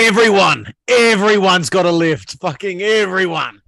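loud, high yelled answer. Pretend. Answering the co-host's lift chant, yelled. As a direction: bellow it as a rallying cry. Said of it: "if you're gonna yell like that".

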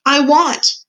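high, loud cry of longing. A narrator's reading. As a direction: cry it out as a desperate girl. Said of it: "cried Mig".

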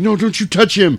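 high, pleading cry; mock protective woman. Star defends Laddie from the brothers, high protest. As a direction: shield him with a hammed-up protest. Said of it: "Star comes out saying".

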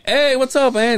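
loud, high-pitched hello; retold eager greeting. Nephew greeting estranged aunt on call, high loud put-on hello. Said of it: "And I'm like".

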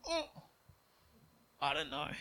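very high, small hesitant squeak; coy reluctance. Acted. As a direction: squirm out a high, hesitant refusal. Acting out reluctance at a gift.

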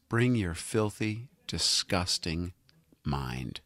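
slow sultry whisper. Acted. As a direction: breathe it out slowly, low and hushed. Repeat dropping to whisper.

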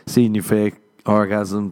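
normal talking voice

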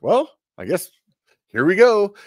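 a half-hearted resigned sigh, pitched high and loud; resigned "here we go". Retold or imitated. Retelling his last thoughts to friends, resigned and mock-calm. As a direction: give up, lightly hammed. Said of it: "It's like".

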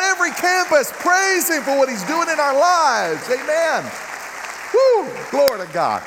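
loud, high, drawn-out praise call; preaching at full force. Acted. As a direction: lift the whole room, shouting praise to God.

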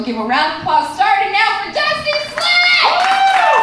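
high, loud, building announcer call; MC introducing the act. Acted. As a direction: build to a drawn-out shout on the name.

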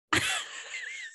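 very high, soft laughter; embarrassed giggle. Real, not acted. Laughing at being called constipated and sweaty, high soft giggle. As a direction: squeal with quiet laughter.

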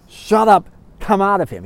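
firm, cutting command; stern rebuke. Acted. Jesus speaking to the thing in the man, stern rebuke. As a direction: order the spirit out sternly. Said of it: "Jesus said very sternly".

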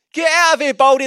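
loud mocking yell of the kids; jeering shout. Kids jeering at the prophet, loud mocking shout. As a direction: shout it like taunting children. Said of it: "they said".

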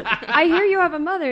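mock the question while cracking up; laughing mock quote. Repeating the producer, laughing.

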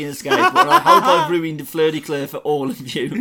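laughing through the words; gloating with a laugh. Real, not acted. Host teasing listeners about the ruined name, laughing.

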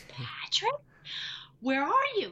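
reunited sister on the phone, thrilled and calling out; excited call. Retold or imitated. Finally reaching long-lost brother.

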